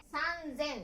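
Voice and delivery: 三千 read "san... zen", voiced z; sanzen. Shown as a demonstration. Teacher counting thousands for learners, san-zen with a z. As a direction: stretch 三, then say 千 as "zen". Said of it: "三だけ".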